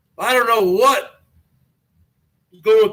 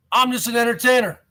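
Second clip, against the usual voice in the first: ordinary delivery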